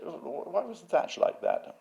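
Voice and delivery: mock sneering, high-pitched query; David Wilson. Museum head questioning the exhibit, imitated with a high sneer. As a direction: put on a sneering critic, pitched well up. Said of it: "he is a sneerer".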